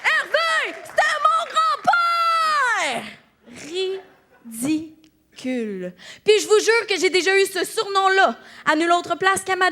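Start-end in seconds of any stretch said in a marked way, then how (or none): 0.0-3.0 very high, drawn-out indignant wail; outraged cry. Acted. Comedian protesting her new introduction, high outraged cry. 4.5-5.9 slow, low and soft, syllable by syllable; disgusted dismissal. Acted. Comedian dismissing the introduction, slow low contempt.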